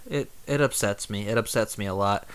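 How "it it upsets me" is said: usual speaking voice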